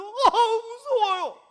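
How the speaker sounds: high, loud, slow whine with a laugh; mock scared whine. Believer whines to God that they are scared.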